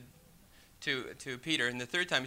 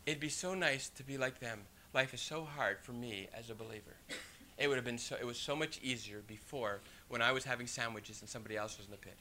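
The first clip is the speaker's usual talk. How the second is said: believer's inner thought; 8 semitones lower, 10 dB quieter than usual. Pretend. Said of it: "sometimes we even think".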